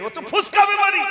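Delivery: high, loud mock scolding; acted exasperation, pitched well up; angry mother. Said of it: "ছেলের উপরে রেগে গেছে".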